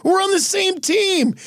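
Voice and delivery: high-pitched pleading wail; mock dismay. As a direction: plead like a betrayed teammate.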